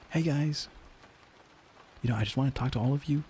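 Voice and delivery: pep up the team like a cheery sales boss; upbeat manager voice. Shown as a demonstration. Manager greeting the sales team, upbeat and bright. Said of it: "I'll just do it like this".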